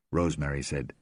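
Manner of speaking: ordinary delivery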